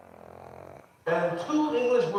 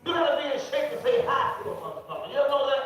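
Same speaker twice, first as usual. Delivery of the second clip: normal talking voice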